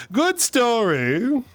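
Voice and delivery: "Good story." dragged out slowly, swooping high with a rising end; mock-proud drawn-out. Shown as a demonstration. Telling her friend the anecdote is over, slow high mock-proud "Good story.".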